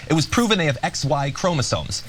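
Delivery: ordinary talk, nothing marked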